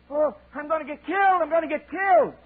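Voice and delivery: very high panicked eaglet; baby bird sure it will die; terrified child. Acted. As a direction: wail in terror. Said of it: "he gives one of those screams".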